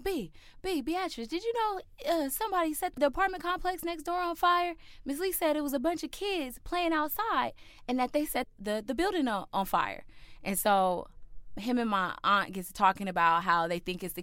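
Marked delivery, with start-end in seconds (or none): 0.0-10.0 high-pitched mock version of an alarmed dad; retold in a raised, urgent dad voice; imitated urgent dad. Dad waking the aunt with news of a fire, imitated high and urgent. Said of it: "he wakes up my aunt. He's like".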